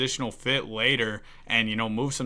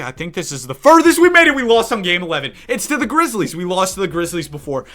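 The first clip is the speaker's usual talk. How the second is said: worked up; 6 semitones higher, 9 dB louder than usual. Real, not acted. Recapping the season loss to viewers.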